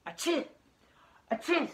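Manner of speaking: loud, high play-acted sneeze; mock sneeze. Shown as a demonstration. Teacher showing children a sneeze, hammed-up sneeze. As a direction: sneeze it out big for the kids.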